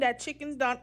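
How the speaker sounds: normal talking voice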